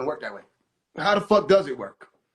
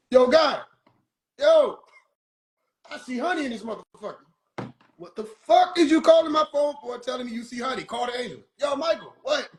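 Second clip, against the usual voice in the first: irritated God; 6 semitones higher than usual. Pretend. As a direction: bark at the caller, fed up.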